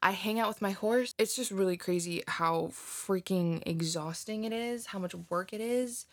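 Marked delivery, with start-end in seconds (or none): none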